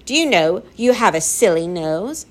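usual speaking voice

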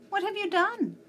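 high, loud indignant demand; storybook girl's angry outburst. A narrator's reading. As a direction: pitch it right up in outrage. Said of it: "she said in an angry voice".

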